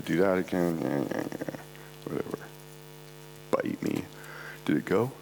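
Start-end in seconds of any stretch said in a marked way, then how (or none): 0.8-4.0 muttering. Real, not acted. Grumbling at a device.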